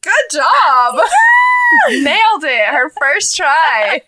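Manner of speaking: loud, high excited cheer. Real, not acted. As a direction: cheer her on like a proud fan. Cheering cohost's pronunciation.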